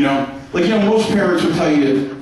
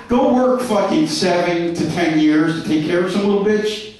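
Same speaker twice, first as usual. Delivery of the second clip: ordinary talk, nothing marked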